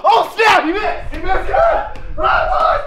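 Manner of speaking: high, breathless game excitement; excited shouting. Real, not acted. Player cheering on the boys as they run, excited shout. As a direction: yell it out in a rush of excitement.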